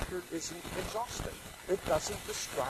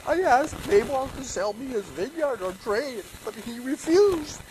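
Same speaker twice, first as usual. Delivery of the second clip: sulking king; 8 semitones higher, 7 dB louder than usual. Acted. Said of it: "Ahab told him".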